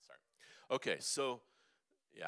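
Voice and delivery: ordinary talk, nothing marked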